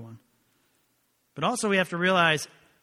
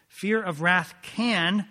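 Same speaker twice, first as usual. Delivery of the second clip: "can" hit harder and held long; slow down and lean on "can"; stress on CAN; 3 semitones higher, 4 dB louder, much slower than usual. Shown as a demonstration. Preacher qualifying his point for the congregation, weighty stress on "can". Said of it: "I stress that word".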